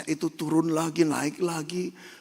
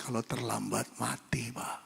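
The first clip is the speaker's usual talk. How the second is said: hushed warning; 3 semitones lower, 7 dB quieter than usual. Retold or imitated. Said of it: "Suster berbisik".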